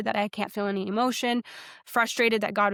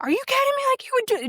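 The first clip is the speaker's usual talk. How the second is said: high, loud, furious outcry at God; retold angry outburst; 3 dB louder, faster than usual. Driver on highway yelling at God, high and furious. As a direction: hurl it at God in disbelief. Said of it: "just angry at God".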